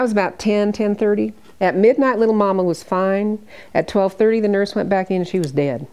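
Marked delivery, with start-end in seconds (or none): none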